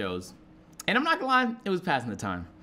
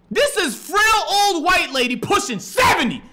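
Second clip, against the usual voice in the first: high-pitched, incredulous shouting; loud, high, worked-up rant; exasperated yelling; 9 dB louder than usual. Acted. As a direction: yell it in total disbelief. Exasperated yelling about screaming lady.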